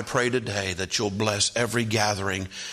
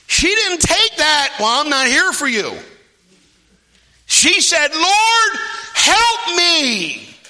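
very high, loud preacher's shout; shouted pulpit storytelling; full-force preaching; 8 dB louder, slower than usual. Acted. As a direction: preach it at full cry, impassioned.

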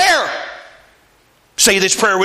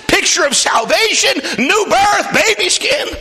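full-force preaching; 11 semitones higher than usual. Acted. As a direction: climb higher with each phrase. Preaching cleansing climax.

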